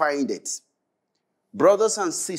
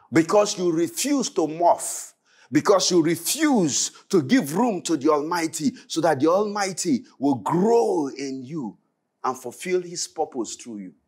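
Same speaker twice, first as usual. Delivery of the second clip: ordinary talk, nothing marked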